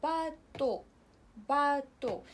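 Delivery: French باتو, final x silent. Shown as a demonstration. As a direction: say باتو cleanly, no x. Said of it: "كيتكتب بهاد الطريقة".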